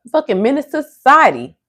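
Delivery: high, fast exasperated rant. Real, not acted. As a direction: go off on the commenter in disbelief. Ranting at morning commenter.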